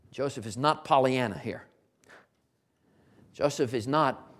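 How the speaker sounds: ordinary delivery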